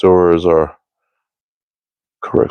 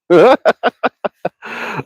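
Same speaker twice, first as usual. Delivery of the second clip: high, long burst of laughter; real laughter; 12 semitones higher than usual. Real, not acted. Laughing at his own accent bit, high real laughter. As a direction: crack up in a high giggling run.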